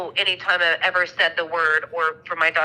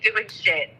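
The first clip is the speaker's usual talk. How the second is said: ordinary talk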